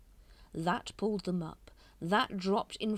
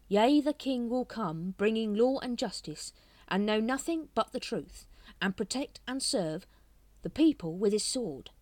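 dramatic recital; 5 semitones higher, 6 dB louder than usual. A narrator's reading. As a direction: intone the old prophecy grandly. Dramatic recall of prophecy.

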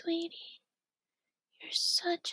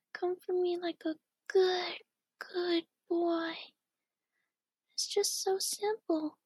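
normal talking voice

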